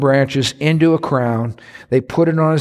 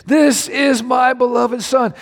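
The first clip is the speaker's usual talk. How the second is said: much higher slow proud call; proud proclamation; 11 semitones higher, slower than usual. Acted. God proclaiming his son at the transfiguration, high proud call. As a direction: announce him with joy. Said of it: "He did it again".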